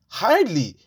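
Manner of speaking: high mocking scoff; scoffing hardly. Son mocks his father's boast with a high scoff. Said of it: "I was like".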